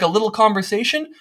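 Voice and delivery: ordinary talk, nothing marked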